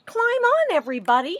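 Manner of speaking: high, loud call to everyone; excited duck calling all aboard; Duck shouting. Acted. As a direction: shout it up high to the whole barnyard. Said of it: "shouted Duck".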